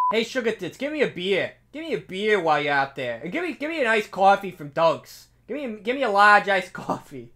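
put-on Boston accent, laughing through it; brash Boston guy ordering dunks. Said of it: "repeat that process in a Boston accent".

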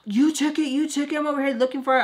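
high-pitched frantic accusing imitation; mock a jittery person making accusations; high, wound-up mocking voice; crackhead accuser. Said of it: "Tommy's crackhead ass was like".

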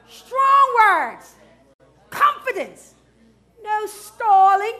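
high, loud proclamation; emphatic preacher's cry; strong, forceful shout. Shown as a demonstration. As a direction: punch it out with full confidence. Preacher demonstrates strong, confident speech.

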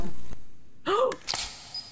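sharp scared gasp; frightened gasp. Real, not acted. Monster behind him.

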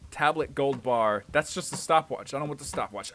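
usual speaking voice

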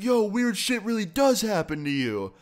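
high-pitched, amazed fan, imitated; retold amazement. Fan realizes he met the YouTuber, mock amazed. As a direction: exclaim it high, as a thrilled fan.

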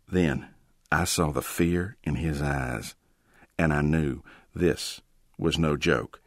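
ordinary delivery, nothing marked

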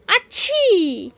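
high, slow mock sneeze aachhi; imitated sneeze. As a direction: act out big brother's sneeze for the baby. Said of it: "दादा कसं करतो?".

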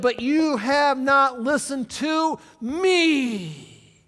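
loud, slow, booming scripture, shouted at the end; full-force preaching, slow and loud. Acted. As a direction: thunder the Lord's words to the church. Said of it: "Let's do it again".

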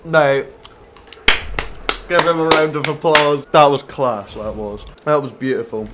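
loud mocking congratulations; mock cheer. Real, not acted. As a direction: cheer him on with heavy sarcasm. Mock praise after being killed.

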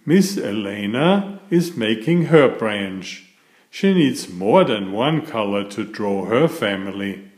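cheerful bedtime story reading; reading to children. A narrator's reading. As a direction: read it like a picture book to little kids. Reads picture book to children.

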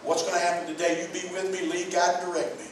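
soft, hushed thanks to God on waking; quiet morning prayer. Shown as a demonstration. Preacher demonstrates a waking prayer for his church, hushed and reverent. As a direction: pray it gently before your feet touch the floor. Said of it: "stop and say".

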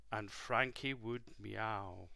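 ordinary delivery

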